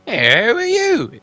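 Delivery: high, slow, squeaky little purse voice. Acted. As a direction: squeak it out like a tiny talking purse. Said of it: "it squeaked".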